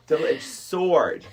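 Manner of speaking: drawn-out double-edged sword; mocking a misheard saying, drawn out; slow mock quote. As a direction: stretch it out slowly, mock-serious.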